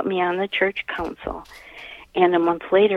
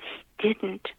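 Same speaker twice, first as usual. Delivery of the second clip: barely voiced answer to an inner voice; quiet whispered realization; whispered reply; 3 semitones higher, 7 dB quieter than usual. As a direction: whisper it as if to yourself. Answering a voice in her head.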